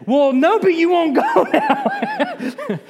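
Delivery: loud, high, breaking into laughter; mock exasperated retort. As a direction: argue back, voice climbing into a laugh. Balaam retorts to talking donkey, comically.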